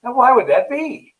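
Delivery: a waiter growing fed up, voice climbing; miffed waiter. Retold or imitated. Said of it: "the waiter gets a little miffed and says".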